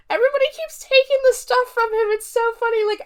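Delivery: high-pitched, tickled and quick; highly amused. Real, not acted. Reviewer recounting a scene that is so funny, high-pitched amusement. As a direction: squeal it out, tickled by the absurdity.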